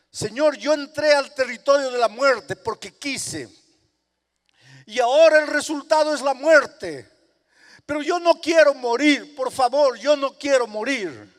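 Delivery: loud, high begging prayer; loud, high cry of a sinner pleading to live; desperate sinner. Acted. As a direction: plead with the Lord for your life. Sinner begging God for life.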